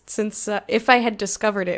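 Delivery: ordinary delivery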